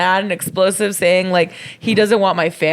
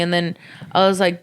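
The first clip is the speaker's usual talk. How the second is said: usual speaking voice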